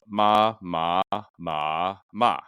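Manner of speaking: each "ma" on a different tone; four separate tonal takes on "ma"; Mandarin tone demo. Shown as a demonstration. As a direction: draw out "ma", changing the pitch shape each time. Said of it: "to give an illustration".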